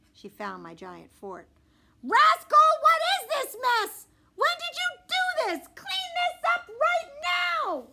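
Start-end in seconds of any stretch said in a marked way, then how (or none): 2.0-7.9 high, loud, slow furious mom scolding; angry mom yelling. Acted. Mom yelling at Rascal about the fort, high loud slow scolding. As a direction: bellow it like a mom finding a giant mess.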